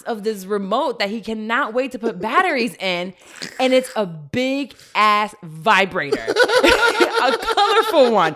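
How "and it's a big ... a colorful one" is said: high, loud, laughing through the words; can't stop laughing as she reveals it; laughing while telling. Real, not acted. Wife telling podcast co-host the story, laughing hard.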